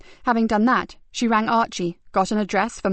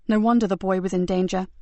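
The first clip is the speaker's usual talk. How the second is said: plain reading voice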